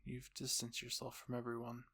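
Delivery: plain reading voice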